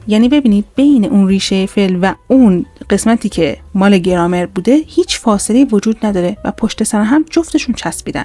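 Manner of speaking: ordinary talk, nothing marked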